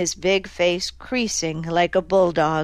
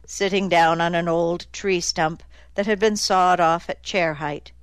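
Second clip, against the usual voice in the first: plain reading voice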